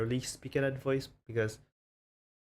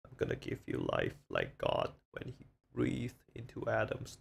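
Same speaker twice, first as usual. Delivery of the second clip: cringey put-on voice. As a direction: lightly play God breathing life in, hushed. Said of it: "I'm cringing from that voice".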